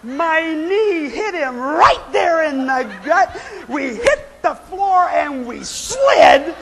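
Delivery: high, laughing disbelief; laughing retelling. Real, not acted. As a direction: laugh your way through the crash. Laughing through the disaster.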